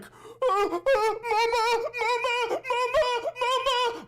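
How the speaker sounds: dad acting the shot medic begging for his mother; high anguished mock wail; dying soldier crying for mama. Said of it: "And he's like".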